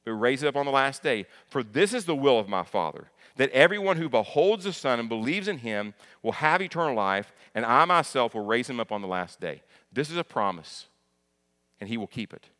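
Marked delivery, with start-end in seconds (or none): 0.0-9.6 louder, driving Bible reading with punched key words; forceful scripture reading. Acted. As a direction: preach the verse out loud and strong.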